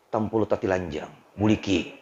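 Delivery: slightly hushed, sly nudge from the devil; devil's whispering temptation. Acted. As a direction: murmur it low, a hint of a tempting devil. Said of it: "iblis membisiki hatiku".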